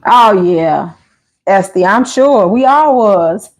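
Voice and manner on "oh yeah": usual speaking voice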